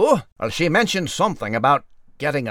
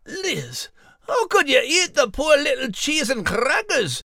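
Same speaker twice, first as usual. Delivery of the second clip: dismayed; 11 semitones higher than usual. Acted. Fish eaten by the cat.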